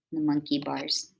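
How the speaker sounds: ordinary delivery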